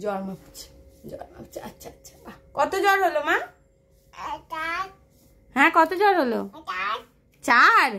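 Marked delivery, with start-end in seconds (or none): 0.0-2.4 fading from low voice to whisper; hushed. Real, not acted. Mother murmuring as her toddler plays doctor, whisper. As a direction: drop to a breathy hush while she plays. Child measuring doll's fever.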